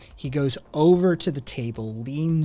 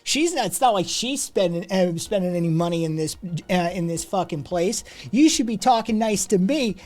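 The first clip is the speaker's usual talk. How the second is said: indignant protest; 6 semitones higher than usual. Acted. Rude customer protesting to the man, indignant and raised. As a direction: whine that you're a paying customer.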